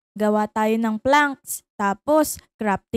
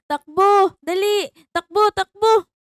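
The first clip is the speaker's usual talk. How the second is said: urgent shout; 6 semitones higher, 5 dB louder than usual. Acted. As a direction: yell to run, fast and loud. Fleeing the TNT blast.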